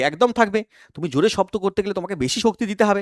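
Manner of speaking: usual speaking voice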